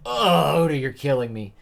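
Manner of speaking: loud, high exasperated groan; mock anguish. Fan complains to the manga's author, mock anguish. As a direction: wail it as a playful complaint.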